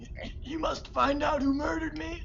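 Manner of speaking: high stammered dying plea. Acted. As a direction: gasp it out while dying. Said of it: "pretending to choke and die".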